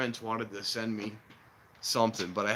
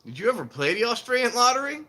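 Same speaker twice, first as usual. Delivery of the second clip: high, louder skeptical question; wife in commercial; 11 semitones higher, 5 dB louder than usual. Retold or imitated. Retelling wife doubting husband, high skeptical. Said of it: "And she goes".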